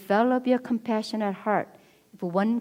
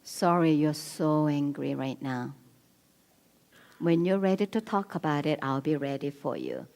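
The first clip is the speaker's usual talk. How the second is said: calm, soft reassurance; 4 semitones lower, 4 dB quieter than usual. Retold or imitated. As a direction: soothe an angry spouse gently. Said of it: "You wanna be".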